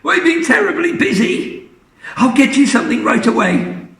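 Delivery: very high, hurried apology; flustered daughter. Acted. Missus Cranky soothing Grandma, high hurried apology. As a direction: rush to placate her mother.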